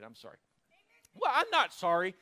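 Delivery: ordinary talk, nothing marked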